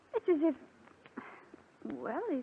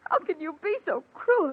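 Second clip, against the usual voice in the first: hurt, higher-pitched snivel; 5 semitones higher, 4 dB louder than usual. Acted. Rose hurt by her cruel sister, sniveling. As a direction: plead through wounded sniveling. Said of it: "stop sniveling like that".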